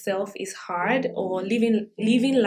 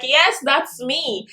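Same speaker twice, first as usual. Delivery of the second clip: loud, high and drawn-out cheer of recognition; exuberant agreement; 5 semitones higher, 7 dB louder, much slower than usual. Retold or imitated. Vlogger cheering along to a Beyonce lyric, exuberant. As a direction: proudly claim it, stretching the words out loud.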